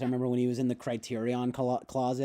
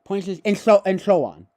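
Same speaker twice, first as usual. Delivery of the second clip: loud, lisped "and so on"; spluttered philosopher tic; Zizek; 5 semitones higher, 8 dB louder than usual. Acted. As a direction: throw it out with a foreign lisp. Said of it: "more in the back of the third".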